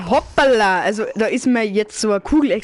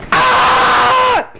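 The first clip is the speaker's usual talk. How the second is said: high, loud frustrated scream; idiot scream; 11 semitones higher, 6 dB louder than usual. Real, not acted. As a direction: let out a raging yell. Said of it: "Das war der allgemeine Deppenschrei".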